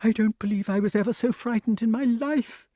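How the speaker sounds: high, fast, breathless chatter; excited snake. A narrator's reading. Snake gushes to Wart about its scare, high and excited. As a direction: babble on, still in a flutter. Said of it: "went on excitedly".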